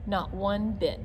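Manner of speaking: ordinary delivery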